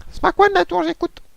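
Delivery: very high squeaky controller voice; control tower voice. Acted. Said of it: "la voix du contrôleur".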